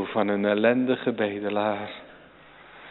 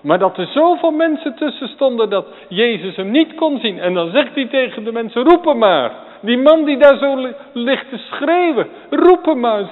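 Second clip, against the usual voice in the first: loud, high, urgent pulpit cry; impassioned preacher voicing Jesus' call; preaching at full force; 11 semitones higher, 7 dB louder than usual. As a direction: call it out over the crowd. Preacher voices Jesus calling out.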